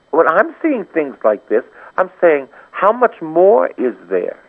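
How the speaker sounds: high, ringing call to repent; fervent preaching. Acted. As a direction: plead with the congregation to come back. Continued raised, fervent preaching.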